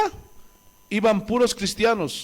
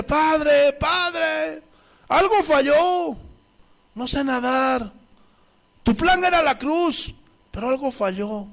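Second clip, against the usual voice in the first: higher, lightly voiced panicking Jesus; mock panicked Jesus; 6 semitones higher, slower than usual. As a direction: play a floundering Jesus crying out to the Father. Preacher mocks a failing Jesus.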